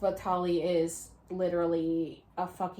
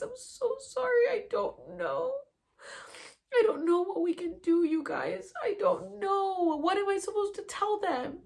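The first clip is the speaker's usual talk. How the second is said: high, tearful pleading apology; imitating tearful pleading; 12 semitones higher, 3 dB louder than usual. Apologising to friends in her vision, high tearful pleading. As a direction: plead through tears, voice pitched up. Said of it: "crying because I was so frustrated".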